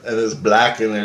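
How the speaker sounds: ordinary talk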